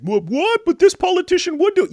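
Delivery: high, mock-naive protest; naive voter. Host mocking a hopeful voter, high mock-naive protest. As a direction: play the gullible voter who still believes. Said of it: "you think".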